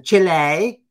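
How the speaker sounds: CHILE as a loud "CHEE-lay" in a mock Spanish accent. Host mocking news readers, overdone Spanish accent. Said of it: "they have to say it like that".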